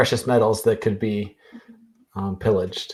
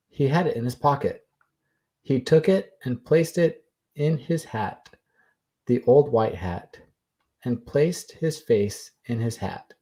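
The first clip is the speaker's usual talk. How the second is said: Martin Harris. Acted. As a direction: recite it deliberately, with pauses between phrases. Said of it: "This is my Martin Harris voice".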